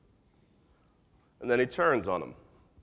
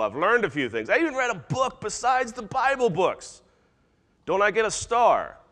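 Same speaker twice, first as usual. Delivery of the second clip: high, pleading boast building higher; eager know-it-all; 4 semitones higher than usual. Acted. Insider telling God he will help, eager boasting. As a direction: plead for credit like an overkeen pupil.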